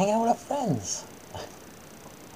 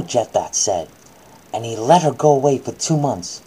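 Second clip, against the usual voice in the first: ordinary delivery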